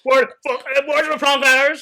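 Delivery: angry customer. Pretend. Customer complaining at takeaway.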